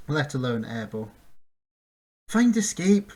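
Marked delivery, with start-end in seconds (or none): 2.3-3.1 loud, higher urgent cry. A narrator's reading. Bink to the griffin, a loud urgent cry. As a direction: call out to the griffin in alarm. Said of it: "Bink cried".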